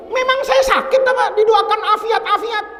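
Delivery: high, mock-indignant retort; high, hammed-up irritation, half-laughing; annoyed. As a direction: play the offended old sheikh. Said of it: "bangun marah".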